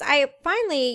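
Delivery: usual speaking voice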